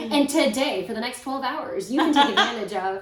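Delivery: loud, high hammy TV pitchman; infomercial voice. Pretend. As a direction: sell it like a late-night ad. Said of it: "am I sounding like an infomercial again?".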